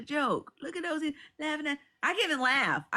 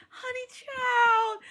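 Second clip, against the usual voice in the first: excited exclamation; 13 semitones higher, 4 dB louder, much slower than usual. Real, not acted. Imagining paying for a roast, a high drawn-out "honey child". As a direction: stretch it out with glee.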